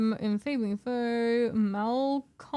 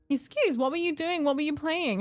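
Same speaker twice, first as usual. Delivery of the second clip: usual speaking voice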